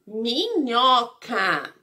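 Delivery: "minhoca" slowly, syllable by syllable; split into syllables. Shown as a demonstration. Teacher forming the little word for pupils, "minhoca" in slow syllables. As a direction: stretch each syllable of "minhoca". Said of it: "vai formar a palavrinha".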